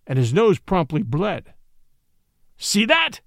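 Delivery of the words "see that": high-pitched mock outcry; crying out. A narrator's reading. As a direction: call out in showy accusation. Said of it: "cried Watson".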